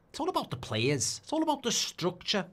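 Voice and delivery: high, put-on pundit voice; Jamie Carragher impression. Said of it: "That's Carragher".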